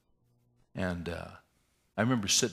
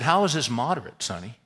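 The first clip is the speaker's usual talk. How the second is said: higher, louder burst of disbelief; incredulous protest; 3 semitones higher, 6 dB louder than usual. Retold or imitated. Patient disputing moderate depression with counselor, incredulous protest. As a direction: challenge it, indignant, then drop on the name.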